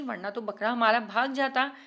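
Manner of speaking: normal talking voice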